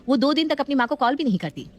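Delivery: ordinary delivery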